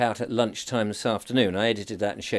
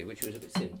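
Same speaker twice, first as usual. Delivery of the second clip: ordinary talk, nothing marked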